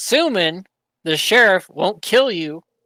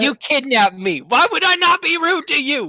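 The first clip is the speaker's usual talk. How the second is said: indignant yell; 8 semitones higher than usual. Acted. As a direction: shout back at the captor. Captive yells at kidnapper.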